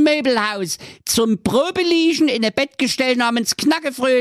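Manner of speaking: sehr hohe, zeternde Hexe; wütende Hexe. Acted. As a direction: spuck den Fluch wütend aus. Hexe verflucht das Kind. (hoher, wütender Hexenfluch)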